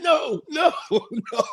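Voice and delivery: high, laughing plea; panicked no. Retold or imitated. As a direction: beg them not to shoot. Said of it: "I said".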